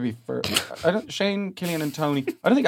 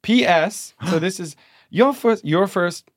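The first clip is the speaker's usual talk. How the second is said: Southern dame; 4 semitones higher than usual. Pretend. Host reading the maid story as a Southern dame. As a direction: read it like a scandalised Southern dame. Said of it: "Southern dame".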